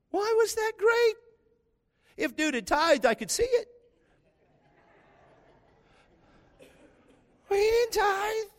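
very high-pitched, comic disbelief; incredulous. Real, not acted. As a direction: shoot up high in protest at the absurdity. Incredulous rant about celebrity visitor.

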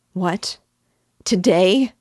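shocked high cry, loud on Today; cried out. Acted. Mother crying out as her son says goodbye. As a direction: gasp it out as if losing him. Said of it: "she cried".

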